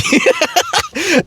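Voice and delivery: a real burst of high laughter. Real, not acted. Laughing at the mom's yelled answer, high. Comedian laughing at own story.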